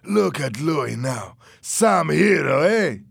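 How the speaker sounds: drawn-out scornful taunting; mocking jeer. A narrator's reading. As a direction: rub it in sarcastically. Fernando jeers at Louis.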